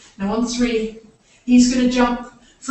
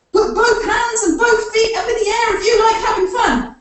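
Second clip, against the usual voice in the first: excited call; 10 semitones higher than usual. Acted. Building game call to children.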